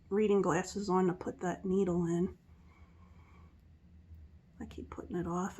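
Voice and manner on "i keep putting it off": whisper. Real, not acted. Guilty confession.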